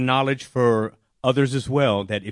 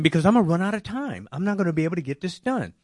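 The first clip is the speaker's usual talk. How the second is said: frustrated; 7 semitones higher, faster than usual. Retold or imitated. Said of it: "I started getting frustrated".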